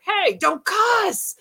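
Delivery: slow, high mock scolding; scolding ghost. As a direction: drag it out like a prim ghost telling her off. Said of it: "he's like".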